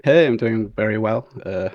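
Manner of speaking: normal talking voice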